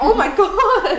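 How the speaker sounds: high, loud, laughing exclamation; hammed-up shock with a laugh; mock horror. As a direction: shriek it in playful disbelief. Retold shock at own slang.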